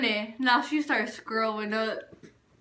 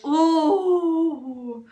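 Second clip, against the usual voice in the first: long, higher drawn-out ooh; emphatic "ooh"; 4 semitones higher than usual. Retold or imitated. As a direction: draw out an ominous ooh. Showing how mad mom was.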